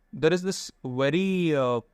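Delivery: usual speaking voice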